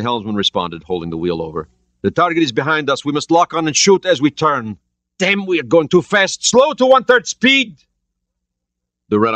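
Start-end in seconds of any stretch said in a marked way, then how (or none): 2.0-7.8 urgent commands. A narrator's reading. Soviet captain during attack.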